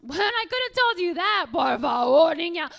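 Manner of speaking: loud, high exasperated outburst; scolding mom. Acted. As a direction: bilingual mother yelling at her girl. Mother scolding daughter loudly.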